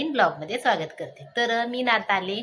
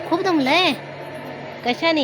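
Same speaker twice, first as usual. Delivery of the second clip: teasing question; 8 semitones higher, 5 dB louder than usual. Real, not acted. Teasing tired son.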